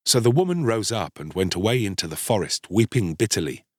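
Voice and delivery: ordinary delivery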